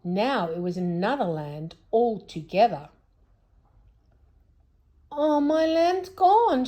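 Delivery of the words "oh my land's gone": high, loud, slow wail of dismay; shrieking. A narrator's reading. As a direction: wail over the lost land. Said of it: "shrieked Moonface".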